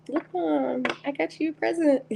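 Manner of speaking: put-on high, eager child holding out a gift, a bit quick; a light, high childlike show-off; proud kid voice. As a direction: voice the Knight as a beaming little kid again. Knight card voiced as child.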